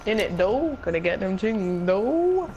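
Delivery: rough London slang drawl; talking like a chav. Pretend. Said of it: "Please stop talking like a chav".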